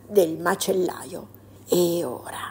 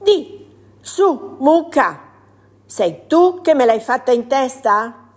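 higher, louder little mole; indignant mole; 5 semitones higher, 6 dB louder than usual. Acted. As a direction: call the cow out. Mole questions the cow.

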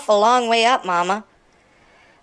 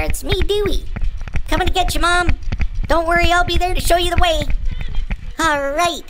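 high, raised call down the stairs; 7 semitones higher than usual. Pretend. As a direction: call reassuringly to someone a floor below. Calling down to Mom below.

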